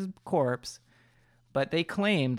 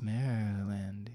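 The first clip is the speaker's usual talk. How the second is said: slow, low ghost-story voice; spooky voice; 4 semitones lower, 6 dB quieter, slower than usual. Pretend. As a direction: drag it out like a campfire tale. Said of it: "did the spooky voice at the wrong part".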